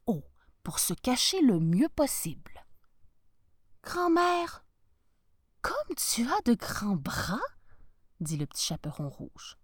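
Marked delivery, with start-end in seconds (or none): 3.8-7.4 put-on little girl voice. Girl wonders at grandmother.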